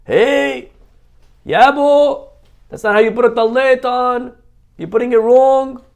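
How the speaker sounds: high, loud scolding yell. Retold or imitated. Man in shul yelling at another about his talit, loud and high. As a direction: yell at a stranger across the shul. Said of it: "he starts yelling at him".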